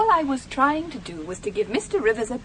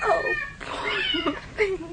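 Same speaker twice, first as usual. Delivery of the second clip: high, soft, drawn-out pity; pitying sigh; 6 dB quieter, much slower than usual. Acted. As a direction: mourn the kitten softly. Woman pities the dead kitten.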